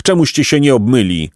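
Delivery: usual speaking voice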